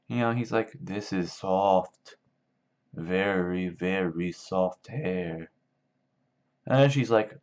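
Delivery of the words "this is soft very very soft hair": slow, drawn-out dopey wonder; entranced simpleton. Retold or imitated. Lenny pets the wife's hair, in slow mock fascination. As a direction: imitate a big simpleton savoring each word slowly.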